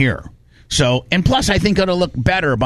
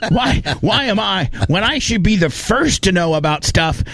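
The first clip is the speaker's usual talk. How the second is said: laughing complaint; 9 semitones higher than usual. Retold or imitated. Retelling his outburst to the crew, high laughing whine. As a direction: whine it through a laugh, pitched up. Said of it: "And I'm like".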